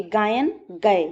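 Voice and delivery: गायन then गए said slowly, drawing out the गए sound; teacher slowly sounding out गायन, गए; slow sound demo. Shown as a demonstration. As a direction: say गायन slowly until गए falls out. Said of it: "इसको दो तीन बार बोलिए".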